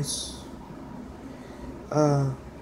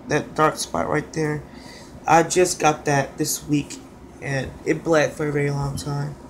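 ordinary talk, nothing marked

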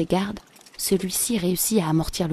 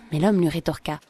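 ordinary delivery

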